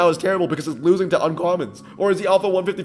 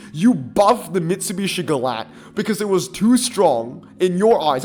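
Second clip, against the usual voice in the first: normal talking voice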